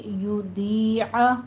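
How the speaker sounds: یُضِيعَ very slow, with the ya long; drawn-out ya. Shown as a demonstration. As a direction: stretch the ya in یُضِيعَ. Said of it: "کھینچ کے پڑھنا ہے".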